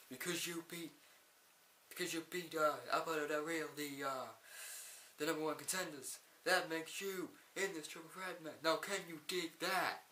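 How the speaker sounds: hammed-up Booker T accent announcing the match. Imagining Booker T announcing a triple threat, bad Booker T accent. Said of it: "I do in a Booker T accent".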